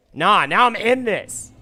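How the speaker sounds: slow, loud rallying cry; fired up. Real, not acted. Player psyching himself back into the game, fired up. Fired up after losing exchange.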